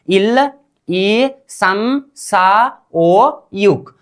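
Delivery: very slow count, each number held and set apart; teacher modelling Korean numbers slowly; pronunciation drill. Shown as a demonstration. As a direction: stretch out every syllable for learners. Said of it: "अब उच्चारण कीजिए आप लोग".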